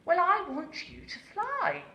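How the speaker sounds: stern mother. A narrator's reading. Mother Owl tells her son to fly, sternly. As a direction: lay down the law to a lazy child. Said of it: "said Mother Owl sternly".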